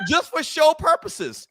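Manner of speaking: teasing. Real, not acted. Drawn-out teasing repeat.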